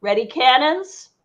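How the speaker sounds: commanding shout, moderately loud; yelled order. Acted. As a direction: call it down to your crew. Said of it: "she yells down to her crew".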